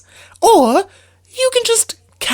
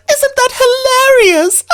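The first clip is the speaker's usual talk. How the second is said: laughing; 7 semitones higher than usual. Acted. Mocking lovesick friend.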